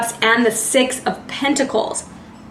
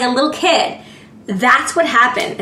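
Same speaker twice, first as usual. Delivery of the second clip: ordinary delivery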